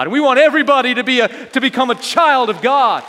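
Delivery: loud, high preacher's proclamation; impassioned preaching. Real, not acted. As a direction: proclaim it to the whole congregation. Preaching at full force.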